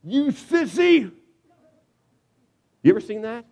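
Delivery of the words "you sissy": high, drawn-out jeering shout; parent yelling. Acted. Little League parent yelling at the fence, jeering shout. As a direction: taunt the kid from the fence, slowly and loud. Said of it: "this is the parent yelling at the fence".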